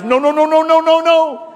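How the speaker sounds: high, loud wailing no; pleading no. Retold or imitated. Church begging a departing couple to stay, wailing high and loud. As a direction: beg them not to leave, loudly.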